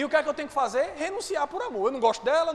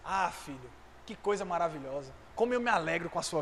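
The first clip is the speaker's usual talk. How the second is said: tender praise; 7 semitones lower than usual. Acted. God voiced praising his child.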